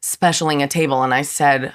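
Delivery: usual speaking voice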